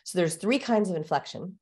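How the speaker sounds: ordinary delivery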